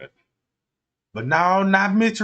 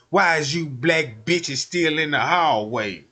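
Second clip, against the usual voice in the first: mock police officer; 6 semitones lower than usual. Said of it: "that police officer said".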